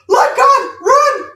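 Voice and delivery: very high, loud, slowed panicked shout; Jamaican exclamation. Retold or imitated. Aunt yells at the children to flee, Jamaican exclamation. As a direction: shriek it like a terrified Jamaican aunt. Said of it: "is like a Jamaican, you know, exclamation".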